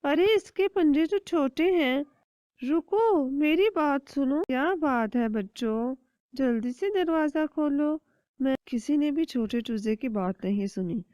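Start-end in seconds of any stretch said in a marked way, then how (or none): 0.0-4.4 much higher, eager little chick; small child chick voice. Acted. 4.4-8.0 trick the chick into opening; a hint of a scheming bird; sly kite. Crow/kite coaxing.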